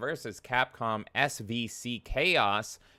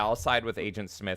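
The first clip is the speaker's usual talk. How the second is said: ordinary talk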